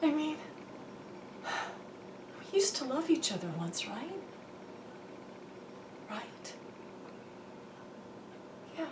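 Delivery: hushed, hurt tenderness after a sigh; really quiet. Shown as a demonstration. Wife to husband about once loving each other, very quiet. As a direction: drop to very soft, with long pauses. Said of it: "you can go really quiet".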